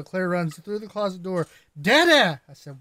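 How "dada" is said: put-on loud, high little-kid shout; mimicked daughter's scolding call; imitated young daughter. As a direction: burst in yelling like an indignant small child. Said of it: "The way you said it is her".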